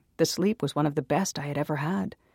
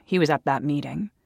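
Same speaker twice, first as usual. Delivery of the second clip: ordinary delivery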